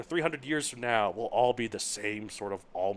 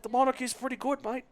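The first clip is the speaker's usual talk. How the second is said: high-pitched put-on New Zealand accent; hammed-up New Zealander seeking agreement; mock New Zealand accent; 13 semitones higher, 5 dB louder than usual. Imitating his monarchist friend, high mock New Zealand accent. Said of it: "In that exact New Zealand accent".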